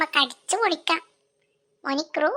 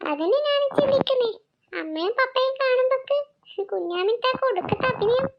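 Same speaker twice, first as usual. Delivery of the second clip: put-on much higher, teasing child voice; cheeky child; 8 semitones higher than usual. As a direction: kid gossiping about the aunt's show. Child mocks aunt's acting. (കുസൃതിക്കുട്ടിയുടെ കളിയാക്കൽ)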